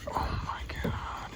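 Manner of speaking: fast, hushed whisper of excitement; excited whisper. Real, not acted. Angler feeling a big fish bite, excited whisper. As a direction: whisper it so you don't spook the fish.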